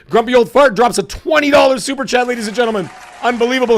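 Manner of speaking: hoarse, cracking hype voice; strained excited shout breaking up. Real, not acted. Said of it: "My voice cracked".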